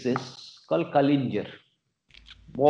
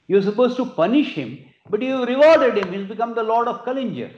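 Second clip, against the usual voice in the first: higher-pitched, lightly acted objection; indignant protest; 6 semitones higher, 3 dB louder than usual. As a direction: challenge the king's decision in protest. Ministers protest the king's decision.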